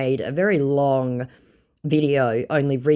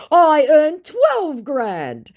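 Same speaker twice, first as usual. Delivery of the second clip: very slow, higher and louder boast; mock flexing scammer; 7 dB louder, much slower than usual. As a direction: drag out the brag like a show-off. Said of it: "flexes my scammer".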